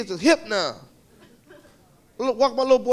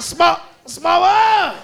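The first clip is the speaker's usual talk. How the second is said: put-on high, loud kid chant; wild kids chanting the ride song; kids yelling; 7 semitones higher, 5 dB louder, much slower than usual. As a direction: yell it like a rowdy kid.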